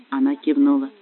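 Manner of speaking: ordinary delivery, nothing marked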